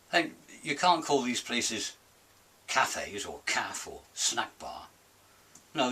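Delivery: ordinary delivery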